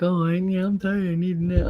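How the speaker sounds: put-on voice of someone claiming to be tired; lightly mocking imitation; mock tired complainer. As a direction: mimic a complainer wanting a nap. Mimics young people claiming tiredness.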